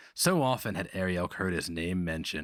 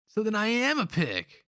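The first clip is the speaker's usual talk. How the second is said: put-on high, indignant young voice; boy's bitter retort; 4 dB louder than usual. A narrator's reading. Young Holka boy retorting bitterly to the slaver. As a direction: throw the word back at him.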